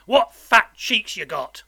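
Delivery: loud, slow, hungry relish; menacing convict. A narrator's reading. Convict eyes boy's fat cheeks, menacing. Convict threatens boy while eating.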